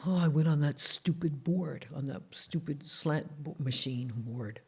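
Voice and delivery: lightly voiced sore dad, low and soft; aching dad. Dad confessing after the sit-ups, soft low grumble. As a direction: grumble softly about the board, trailing off. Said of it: "He says".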